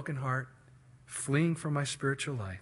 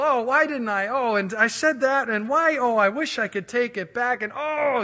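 high, loud mock lament; hammed-up wail of regret; regretful; 8 semitones higher, 6 dB louder than usual. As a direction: agonize over what was said, pitched up. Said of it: "those moments of regret".

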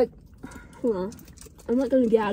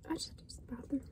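unvoiced, hushed whisper; teen whispering an excuse to her dad; 18 dB quieter, faster than usual. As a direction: breathe it out guiltily, barely voiced. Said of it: "And I was like".

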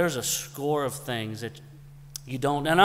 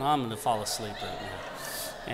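muttered aside; 6 semitones lower, 7 dB quieter than usual. Retold or imitated. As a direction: lower and softer tired mutter, half laughing. Weary aside while she chats.

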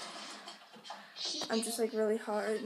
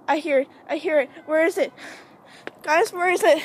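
loud, raised, urgent questioning; alarmed; 4 semitones higher, 10 dB louder than usual. Pretend. Vlogger hunting the clown they claim to hear, alarmed. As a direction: call out in mounting alarm.

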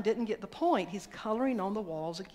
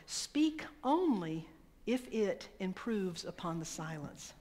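slow solemn quote; slower than usual. Acted. Quoting Gandhi slowly.

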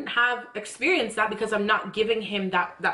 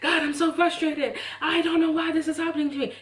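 higher-pitched exasperated plea to God; frustrated prayer; 7 semitones higher than usual. Retold or imitated. Praying to God about an unexplained trouble, frustrated. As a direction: vent frustration upward in prayer. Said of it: "when I'm praying and I'm like".

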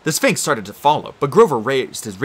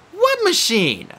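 put-on girl's voice; much slower than usual. Annabeth voiced in story.

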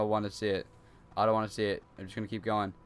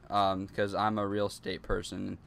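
usual speaking voice

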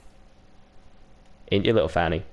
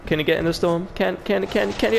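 getting more and more worked up, pitch rising; excited disbelief; 10 semitones higher than usual. Real, not acted. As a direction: voice climbs higher with each "can". Watching an enemy flee into the storm.

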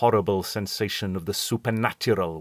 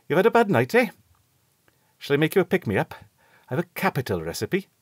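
doctor voice; 7 semitones higher, faster than usual. A narrator's reading. Doctor offers a pick-me-up.

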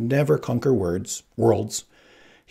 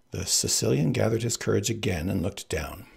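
ordinary delivery, nothing marked

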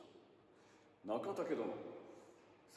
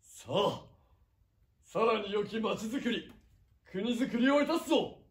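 loud, slow stage declamation as a samurai lord; theatrical feudal lord; 5 semitones higher, 6 dB louder than usual. Acted. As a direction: proclaim it like a warlord on stage. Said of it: "再現お芝居でござった".

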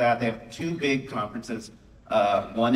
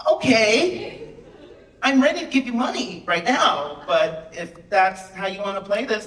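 high, puzzled editor voice with a little laugh, slower at the end; confused editor; 9 semitones higher, 4 dB louder than usual. Retold or imitated. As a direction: play a baffled big-shot editor. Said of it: "he looked confused at me".

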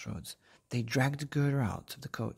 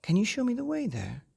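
put-on eager girl; 11 semitones higher, 5 dB louder, faster than usual. Gerda asks crow.

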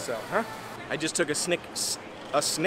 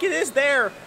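excited; 8 semitones higher than usual. Retold or imitated. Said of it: "and I'm like".

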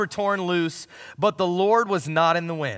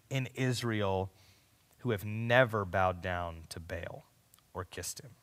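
much softer, hushed and patient; gentle voice of God; 7 semitones lower, 6 dB quieter than usual. Acted. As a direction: speak it quietly, like a gentle whisper. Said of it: "in that gentle whisper, this is what God says".

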